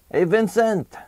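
put-on a passer-by hailing the boy; neighbor voice. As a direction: call out a friendly hello to Vincent. Neighbor greets Vincent.

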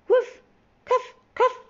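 high, loud puffs of a toy train; imitated train chuffing. A narrator's reading. Storyteller makes the strange sound that wakes Timmy, high train puffs. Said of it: "awakened by a strange sound".

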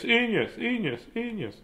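high fading echo effect. Acted. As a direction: let it echo away. Echoing fading repetition.

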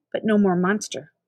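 plain reading voice

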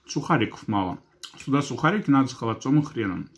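talking between bites of salad; muffled speech around food; mouth full, chewing. Real, not acted. Seen 2: Eats salad from fork, chewing while speaking throughout the words.

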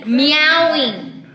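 stretched word, climbing high on the repeat; drawn-out enunciation. Pretend. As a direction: say it slowly for someone who can't hear. Mouthing word for guesser.

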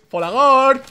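high, loud drawn-out name call; gloating shout. Real, not acted. Streamer calling out Folagor as the sandwich comes, gloating shout. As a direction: crow the rival's name loudly.

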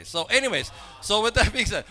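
loud, high-pitched transition; over-the-top segue. Real, not acted. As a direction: push the segue out too big. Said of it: "was that too much?".

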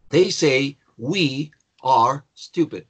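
very slow, separated words; slow teacher's dictation with clear pauses; word-by-word model. Shown as a demonstration. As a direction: say each word on its own for learners. Repeats example word by word.

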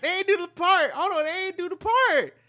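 loud, high-pitched disbelieving reaction; excited outburst. Real, not acted. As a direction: cry out over and over, carried away. Outburst reacting to the music video.

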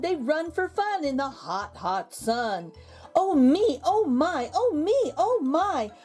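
plain reading voice

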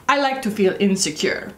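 put-on light Italian accent on "I like to feel insecure"; gentle imitation of an Italian designer's accent; imitating Italian accent. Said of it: "with his beautiful Italian accent".

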